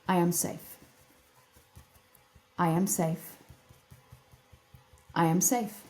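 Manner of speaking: soft, steady repeated affirmations; quiet affirmation. Shown as a demonstration. Teaching listeners to tap and affirm, soft and steady. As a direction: murmur each line as a calm promise to yourself. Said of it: "Let's all do this right now".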